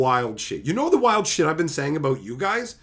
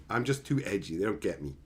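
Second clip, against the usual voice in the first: low, softer mock-brooding; mock sulky, softer edgy loner; 9 semitones lower, 6 dB quieter than usual. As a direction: play the misunderstood edgelord. Mock edgy self-pity.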